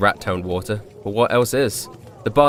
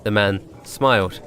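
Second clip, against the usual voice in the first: plain reading voice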